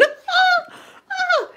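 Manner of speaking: high-pitched retold shriek; mock scream. Retelling a housemate hearing of a death, mock screaming. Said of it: "Tiffany started screaming".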